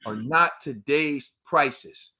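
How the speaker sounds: slow, each word laid down like a rule; slow and deliberate. Real, not acted. Telling Crawford's fans his price has dropped, slow and deliberate. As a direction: spell it out slowly for the fighters. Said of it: "remember this".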